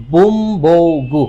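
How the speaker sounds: বুমবৌগু stretched out syllable by syllable, bum-bou-gu, very slowly; teacher modelling বুমবৌগু syllable by syllable for students to repeat; slow model pronunciation. Shown as a demonstration. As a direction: draw out বুমবৌগু slowly and evenly so the class can copy. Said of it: "এইটার উচ্চারণটা হচ্ছে".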